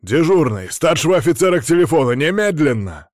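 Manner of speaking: loud, high, rapid-fire command; barked order. A narrator's reading. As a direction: bark an urgent demand down the phone. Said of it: "рявкнул в трубку князь". (громкий резкий приказ, рявкнуть в трубку)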